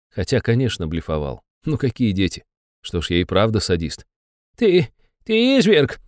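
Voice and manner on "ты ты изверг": slow, high hissing accusation; hissed. Acted. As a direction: hiss it out, outraged. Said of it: "прошипел Анатолий Дмитриевич".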